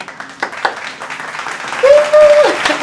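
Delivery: squealing laugh; high laugh. Real, not acted. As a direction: burst into a high giggle. Laughing alone.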